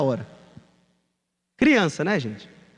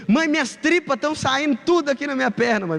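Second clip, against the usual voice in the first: panicked cry; 8 semitones higher than usual. Retold or imitated. Bleeding boy crying to mother.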